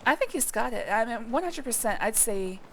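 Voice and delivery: ordinary talk, nothing marked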